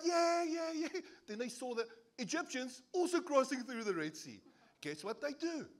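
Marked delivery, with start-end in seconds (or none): 0.0-1.0 high loud mock cheer. Israelites on the far shore, in hammed-up cheering. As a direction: cheer like you just got across. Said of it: "They're like".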